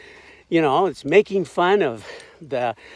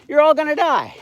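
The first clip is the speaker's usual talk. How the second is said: much higher mock prophet cry; mock doom warning; 10 semitones higher, 4 dB louder than usual. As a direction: squeal the doom like a cartoon prophet. Retelling Jonah's one-line warning.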